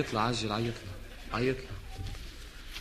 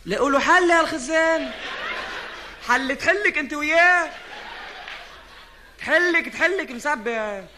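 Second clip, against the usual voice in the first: louder, higher scolding rant; exasperated mom scolding; 6 semitones higher, 5 dB louder, slower than usual. Acted. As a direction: scold her, fed up. Scolding about the tank.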